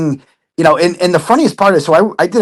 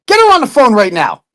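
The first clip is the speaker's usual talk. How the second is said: demanding retold order; 6 semitones higher, 4 dB louder than usual. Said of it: "I'm like".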